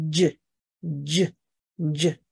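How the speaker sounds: slow, drawn-out "J，J，J" like the dg sound in lodging; teacher modelling the "J" sound; J sound demo. Shown as a demonstration. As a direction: sound out "J" slowly three times. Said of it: "这个就是D G的发音".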